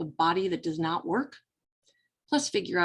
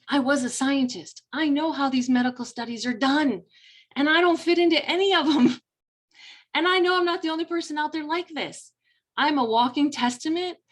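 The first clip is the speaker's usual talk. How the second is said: much higher and louder, rising with conviction; defiant, higher-pitched surge of passion; fired up; 8 semitones higher, 4 dB louder than usual. Real, not acted. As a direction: build up passionately on how you defy the studies. Passionate defiant self-assertion.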